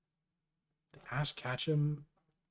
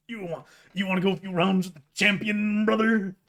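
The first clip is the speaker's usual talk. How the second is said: louder, higher wrestler promo voice; Hulk Hogan impression; 7 semitones higher, 7 dB louder than usual. Streamer on chat's request, louder Hulk Hogan impression. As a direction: ham up a wrestling champion's challenge. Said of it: "I can't do a good Hul".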